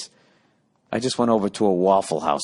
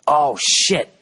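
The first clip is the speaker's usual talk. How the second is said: ordinary talk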